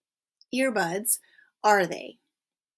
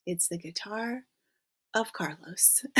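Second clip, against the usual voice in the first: whispered aside; 2 semitones lower, 7 dB quieter than usual. Shown as a demonstration. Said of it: "jokey literal translation".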